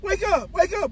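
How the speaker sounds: very high, loud yell at the sleeping driver; real yell to keep the driver up; yelling to wake him. Real, not acted. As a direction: shout him awake, full force. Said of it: "You just loud for no reason".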